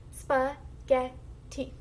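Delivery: Spaghetti in three separate slow beats; syllable by syllable. Shown as a demonstration. Teacher lets the class try blending, syllable by syllable. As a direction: break Spaghetti into isolated syllables with gaps. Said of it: "we are blending into three syllables".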